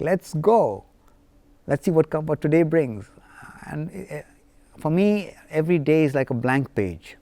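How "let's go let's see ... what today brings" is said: higher, lively "Let's go, let's see"; eager cheer. Retold or imitated. Man waking up to a new day, excited and eager. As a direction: greet the day with keen excitement. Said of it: "It's like".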